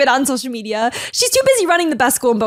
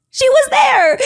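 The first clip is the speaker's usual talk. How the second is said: much higher and louder, thrilled; emphatic excited; 14 semitones higher, 8 dB louder, slower than usual. Real, not acted. Telling viewers the rebbitzin always came, excited. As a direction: burst out with it.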